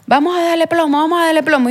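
imitating the gunman's push to shoot; mock kidnapper order. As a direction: bark it like the armed thug. Kidnapper shouting orders, retold.

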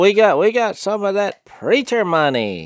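ribbing him about preacher money; teasing mechanic. Retold or imitated. Mechanic teasing, voiced high.

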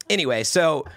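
ordinary delivery

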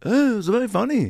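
very high imitated rock star voice; high, light celebrity impression. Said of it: "And he goes".

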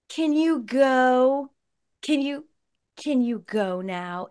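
high, slow, smug "can you go"; condescending toddler. Retold or imitated. Toddler dismissing mom, high slow smug. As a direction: talk down like a tiny manager. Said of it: "most condescending shit anyone has ever done".

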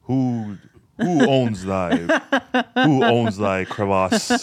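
slow, mock-Shakespearean dirty talk; Shakespearean English. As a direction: ham it up in Old English. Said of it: "dirty talking Old English".

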